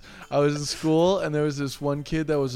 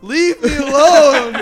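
louder, higher and slow, pleading yell; mock yell; 8 semitones higher, 12 dB louder, slower than usual. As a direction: whine it loud at the follower. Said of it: "the guy's like".

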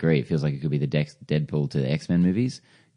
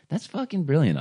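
excited high exclamation; 12 semitones higher than usual. Retold or imitated. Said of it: "I'm like".

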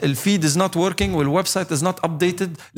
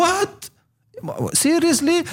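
high, incredulous what and seriously; high pitched mock shock; mock disbelief; much slower than usual. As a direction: act stunned at a broken website. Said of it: "إيه، بقول".

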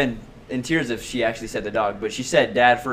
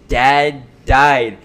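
retold sister's words; 3 semitones higher, 10 dB louder than usual. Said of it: "I heard her say".

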